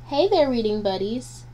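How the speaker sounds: ordinary delivery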